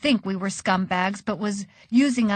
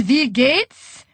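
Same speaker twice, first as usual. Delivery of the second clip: put-on called out higher in a German accent; heavy German accent; 4 semitones higher, 4 dB louder than usual. A narrator's reading. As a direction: greet them in a thick German accent. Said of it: "called in her heavy German accent".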